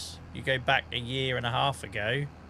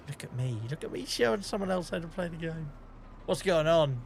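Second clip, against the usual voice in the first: mock amazement; 4 semitones higher than usual. Real, not acted. Mock-marvels at helping others.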